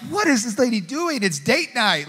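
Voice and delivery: high, put-out disbelief; husband stunned the stranger spoils date night, exasperated; incredulous indignation. Retold or imitated. Annoyed at car parking beside them.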